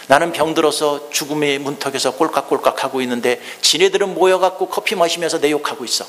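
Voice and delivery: usual speaking voice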